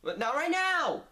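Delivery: loud, annoyed call back; exasperated shout. Real, not acted. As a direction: yell back, fed up. Dad keeps calling, exasperated.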